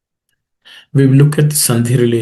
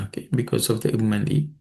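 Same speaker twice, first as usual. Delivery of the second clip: normal talking voice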